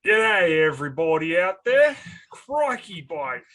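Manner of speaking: hammed-up Aussie greeting, loud and high; enthusiastic archetypal Australian. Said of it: "enthusiastic, archetypal Australian g'day".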